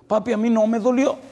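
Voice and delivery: put-on high, loud child's cry; son crying out. A son shouts to comfort his crying father, high and loud. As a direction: cry it out to papi at full force.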